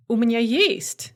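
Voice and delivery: ordinary delivery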